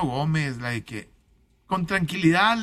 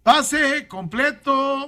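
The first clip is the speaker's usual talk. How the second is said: excited call; 7 semitones higher, 3 dB louder, much slower than usual. Acted. Football play call.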